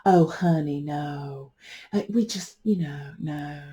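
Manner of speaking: lightly voiced relative shutting it down; family member quietly refusing the idea; dismissive Southern relative. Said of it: "I should be in voice work".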